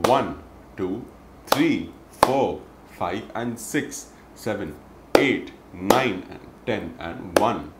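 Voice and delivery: "three, four" punched hard together; double clap on three and four. Shown as a demonstration. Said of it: "a double clap on three and four".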